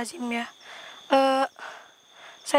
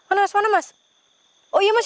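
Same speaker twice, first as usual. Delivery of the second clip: alarmed cry; 7 semitones higher, 6 dB louder than usual. Real, not acted. Spotting a ghost, alarmed.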